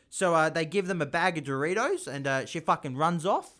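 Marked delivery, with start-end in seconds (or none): none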